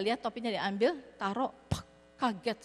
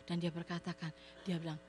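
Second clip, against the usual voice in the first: ordinary talk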